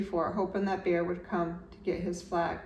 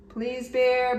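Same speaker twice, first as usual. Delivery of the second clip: begging; 5 semitones higher, 5 dB louder than usual. A narrator's reading. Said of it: "begged Franklin".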